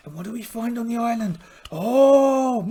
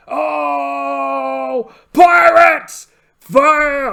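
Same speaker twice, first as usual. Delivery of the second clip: much higher, loud alarm shout; battle shout; 5 semitones higher, 10 dB louder, much slower than usual. Acted. Pirate captain spotting enemy ships, shouting to fire. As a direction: bellow the order to fire.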